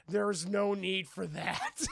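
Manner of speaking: slow, put-on oafish character voice; big glutton's voice. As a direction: draw it out as a lumbering big eater. Voices big Fred at lunch.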